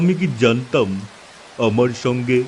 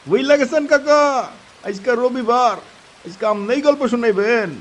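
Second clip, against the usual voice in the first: much higher, coaxing voice; excited young friend; 10 semitones higher than usual. Acted. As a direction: push the uncle to tell the tale. Friend urging uncle to tell story. (উত্তেজিত তরুণ বন্ধুর অনুরোধ)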